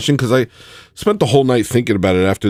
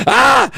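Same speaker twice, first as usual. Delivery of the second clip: high, louder anguished yell; mock yell; 12 semitones higher, 4 dB louder than usual. Real, not acted. Streamer craving nicotine yells out. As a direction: let out a wild yell of craving. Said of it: "this insatiable".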